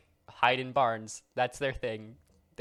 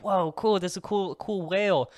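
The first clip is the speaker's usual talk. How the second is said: mock wonder; 7 semitones higher, 4 dB louder than usual. Imagined boater sees whale.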